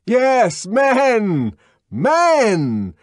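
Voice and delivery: high, loud triumphant shout; exultant shout. A narrator's reading. Savage cheering Helmholtz beside him in the fight. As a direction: hurl it out with joy.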